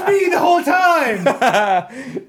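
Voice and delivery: loud, high, through laughter; laughing shout. Real, not acted. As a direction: yell it while cracking up. Laughing at own mistake.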